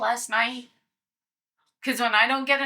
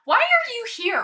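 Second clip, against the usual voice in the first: high, loud challenge; demanding shout; 11 semitones higher, 5 dB louder than usual. Acted. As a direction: call it out sharply. Player voices character confronting dragon.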